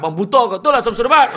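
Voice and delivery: loud, high mock scold; wife scolding her husband, loud mock anger. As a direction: snap at the husband like his wife. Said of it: "Bini dia marah".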